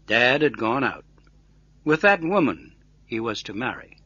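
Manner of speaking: ordinary delivery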